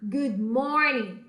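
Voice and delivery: slow, clear Good morning for repeating; slow model phrase. Shown as a demonstration. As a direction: say it slowly so they copy. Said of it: "Vamos treinar?". (pronúncia lenta e clara)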